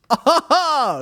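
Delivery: high, loud fake laugh; hammy high laugh. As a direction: laugh at your own joke. Character laugh.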